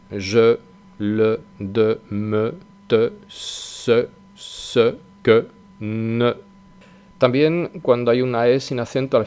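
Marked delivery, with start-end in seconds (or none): 0.1-6.3 "je, le, de, me, que, te, se, ne" each ending in a rounded schwa; French monosyllables. Shown as a demonstration. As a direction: list the words and stress the final E sound. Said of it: "Veis que estoy diciendo E". (cada "E" final con schwa francesa)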